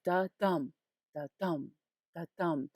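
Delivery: "da-dum" with a soft "da" and heavier "dum"; soft-heavy beat. Shown as a demonstration. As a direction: say "da-dum" like a heartbeat, weight on "dum". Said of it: "first one is soft and the second one is louder".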